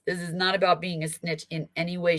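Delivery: ordinary talk, nothing marked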